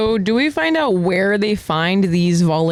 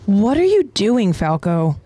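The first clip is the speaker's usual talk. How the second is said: confused; slower than usual. Acted. Confused Gabi questioning Falco, drawn-out puzzlement. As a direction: stretch it out in bafflement, falling on the name. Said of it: "A confused Gabi asks".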